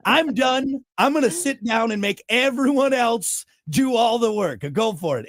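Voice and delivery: playing the pompous club president; mock club president speech. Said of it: "I was just up there like".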